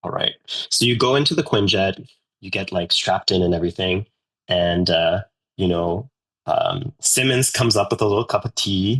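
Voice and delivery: normal talking voice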